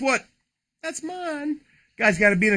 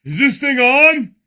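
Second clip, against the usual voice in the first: louder, higher, slow announcer-style mic check; mock mic check; 7 semitones higher, 9 dB louder, slower than usual. Host joking to viewers about the mic, slow loud mock emcee.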